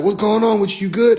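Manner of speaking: imitated bystanders calling over; concerned elders calling. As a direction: call out to see if he's okay. Elders call out to him.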